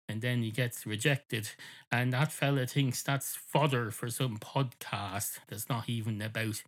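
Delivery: ordinary talk, nothing marked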